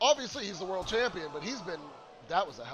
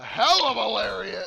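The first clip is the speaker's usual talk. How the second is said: excited; 3 semitones higher, 10 dB louder than usual. Real, not acted. Commentator calling a big move.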